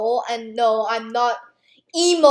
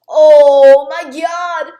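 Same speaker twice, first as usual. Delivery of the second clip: shouting; 3 semitones higher, 12 dB louder, much slower than usual. Retold or imitated. Panicked quote, very loud.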